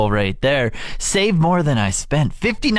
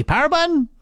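mock incredulous question; 14 semitones higher, faster than usual. Real, not acted. Exasperated rhetorical.

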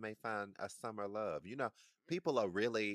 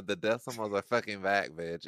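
triumphant; 8 dB louder than usual. Real, not acted. Triumphant loud declaration.

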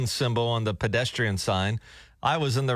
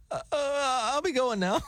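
scared backing off; 11 semitones higher than usual. Retold or imitated. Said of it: "he's like".

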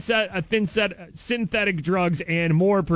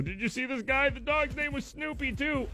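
goofy mock cheer, higher and softer; silly clown; 7 semitones higher, 5 dB quieter than usual. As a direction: play the fool celebrating a gross story. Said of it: "just laugh about and just go".

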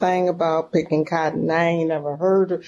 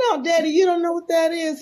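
tearful correction; 10 semitones higher, faster than usual. Retold or imitated. Daughter answers, half crying.

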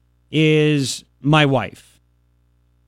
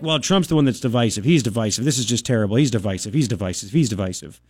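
lower, softer, repeated mock complaint; mock whiny players; 8 semitones lower, 4 dB quieter than usual. Host mocking players complaining about Trump, mock whiny and lower. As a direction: imitate players droning the same gripe. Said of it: "they're all like".

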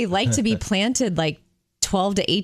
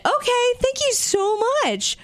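surprised thanks. Retold or imitated. Accepting a surprise gift.

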